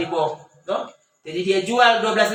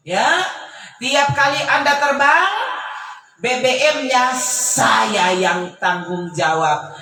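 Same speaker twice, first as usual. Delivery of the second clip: emphatic preaching; 2 semitones higher, 5 dB louder, much slower than usual. Acted. Preacher voices generous caller.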